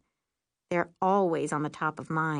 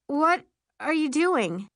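high, slow, nervous question; 8 semitones higher, 5 dB louder, slower than usual. A narrator's reading. Passenger on circling broom, nervous. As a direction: ask with rising alarm, pitched up. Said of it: "I ask nervously".

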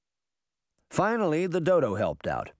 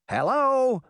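put-on high, impatient boy; exasperated high call for attention; impatient cry; 11 semitones higher, 4 dB louder than usual. A narrator's reading. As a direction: butt in impatiently, pitched right up. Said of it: "Puck cried impatiently".

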